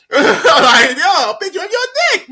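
high, loud laughing repeat; cracking up. Real, not acted. Storyteller repeats the crude request, cracking up. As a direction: squeal the quote through laughter.

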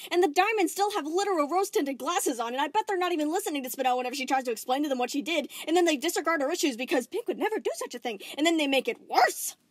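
high, loud, fast building rant; frustrated rant. Acted. Steven ranting about the Diamonds, frustrated. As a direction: let the frustration run on.

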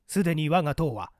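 usual speaking voice

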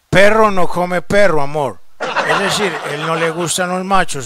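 ordinary talk

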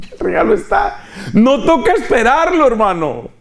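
laughing high and bright with joy; laughing high with joy at the gift; joyful laugh. Real, not acted. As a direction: laugh it out, overjoyed.

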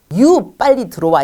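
"You 빨리 들어와" spoken slowly and firmly; slow you-message gloss. Shown as a demonstration. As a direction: spell out "You 빨리 들어와" slowly as the blunt version. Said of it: "이거잖아요". ("You 빨리 들어와"를 천천히 또박또박)

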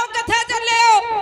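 high, loud shout after fleeing men; calling out. A narrator's reading. Commander shouting after his fleeing soldiers, loud. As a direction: yell across the battlefield. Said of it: "ਆਵਾਜ਼ਾਂ ਮਾਰਦਾ".

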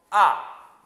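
"아" starting high, plunging sharply, loud; fourth tone. Shown as a demonstration. Teacher modelling the fourth tone, loud sharp drop. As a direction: fire "아" straight down from the top. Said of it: "내리 꽂아야 돼요".

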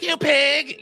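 high-pitched mock outburst; sudden high angry shout; mock angry yell. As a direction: bark it out high, in mock fury. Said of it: "I will be like".

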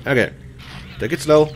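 ordinary talk, nothing marked

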